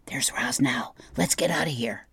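hushed warning to companions; urgent whisper. A narrator's reading. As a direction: whisper it, keen to slip away unnoticed. Animals hushing as the robot approaches.

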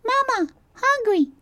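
very high, tiny chick voice; peeping gosling. A narrator's reading. Brightbill peeps to his robot mother, tiny and hungry. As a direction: peep it like a hungry baby bird. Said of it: "started peeping".

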